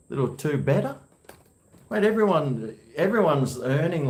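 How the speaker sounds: ordinary talk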